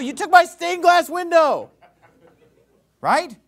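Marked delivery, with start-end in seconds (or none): 0.0-1.7 mock outrage. Mock Micah's outraged complaint.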